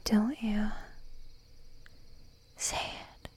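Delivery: ordinary talk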